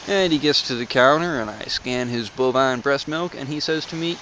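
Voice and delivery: ordinary talk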